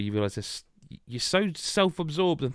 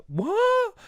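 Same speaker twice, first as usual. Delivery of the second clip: shrieked, much higher What?; a squeaky anime double take; anime shock; 4 dB louder than usual. Retold or imitated. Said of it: "the anime moment of like".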